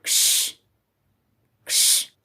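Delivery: कश breathed out, no voice, no vowel; just the k-sh noise, voiceless; कश whispered as a bare cluster; voiceless ks sound. Shown as a demonstration. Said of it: "इस तरीके से".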